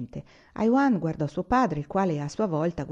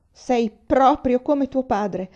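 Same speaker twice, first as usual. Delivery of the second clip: slow, much higher, louder protest; mother protesting to her stubborn son; 6 semitones higher, 8 dB louder, much slower than usual. Acted. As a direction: drag it out in reproach. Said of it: "protestò".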